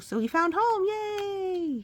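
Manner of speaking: happy yay; cheering. Real, not acted. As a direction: celebrate the happy ending. Cheering to child at happy ending.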